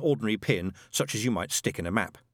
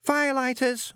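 put-on high, resigned young recruit; sad Newt; 14 semitones higher than usual. A narrator's reading. Newt resignedly confirming to Shadwell, high and sad. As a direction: sigh it out in resignation. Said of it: "said Newt sadly".

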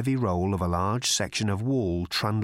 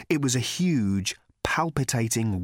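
plain reading voice

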